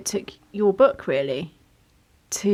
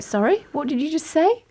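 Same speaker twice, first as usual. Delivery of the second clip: high, taken-aback question; acted double take at what was just said; startled questioning; 8 semitones higher than usual. Interviewer stopping the doctor mid-explanation, startled.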